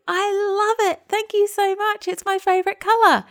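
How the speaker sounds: put-on high, gushing thanks to a child; gushing praise. Mum gushing over her son's cheap gift. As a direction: lay the delight on thick for him.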